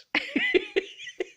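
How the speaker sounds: very high squeaky laugh. Real, not acted. Laughing at the wrong TV remote, squeaky high laugh. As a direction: let a squeaky laugh escape.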